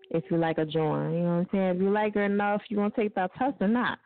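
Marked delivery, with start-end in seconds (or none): none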